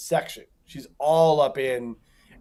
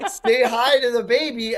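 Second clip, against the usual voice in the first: mimicking seatmate; 6 semitones higher than usual. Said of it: "She's like".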